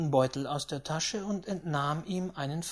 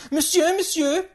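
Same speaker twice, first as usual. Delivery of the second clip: high, loud hail; excited old lady calling in French; 13 semitones higher, 10 dB louder than usual. Acted. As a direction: get the croupier's attention urgently. Great-aunt hails the croupier.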